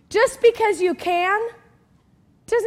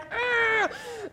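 strained high squeezed groan; straining grunt; 5 semitones higher, 8 dB quieter than usual. Acted. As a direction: grunt as if forcing a stuck lid. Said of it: "I'm getting so frustrated".

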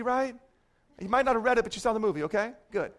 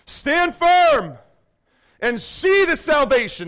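loud, higher ringing declaration; proclaiming Moses; 6 semitones higher, 5 dB louder, slower than usual. Retold or imitated. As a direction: stand tall and proclaim to the crowd. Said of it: "Moses said to the people".

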